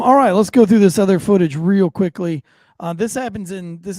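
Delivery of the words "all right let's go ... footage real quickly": loud announcing. Real, not acted. Loud segment transition.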